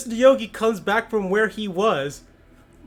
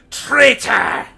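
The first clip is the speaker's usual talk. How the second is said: loud cry of betrayal; accusing shout; 4 semitones higher, 9 dB louder than usual. Acted. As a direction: spit the word out loud. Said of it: "you hear him say".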